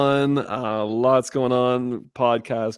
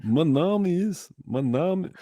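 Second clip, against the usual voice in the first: put-on slow, high French accent on German words; mock French accent in German; 7 semitones higher than usual. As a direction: play a Frenchman fumbling his German intro. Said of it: "French accent in German".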